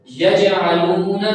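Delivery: slow یجعلون with a light bounce on the jeem; light qalqala. Shown as a demonstration. Teacher demonstrates the jeem, slow یجعلون with light qalqala. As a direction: give the ج in یجعلون a slight echo. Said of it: "کلکلا کرنا ہلکا سا".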